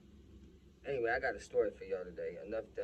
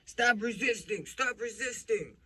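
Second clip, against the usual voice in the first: high, drawn-out cop yell, acted out; shouted police command; 11 semitones higher, 6 dB louder than usual. Cop on top of dad, shouting commands. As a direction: imitate an officer shouting at a suspect.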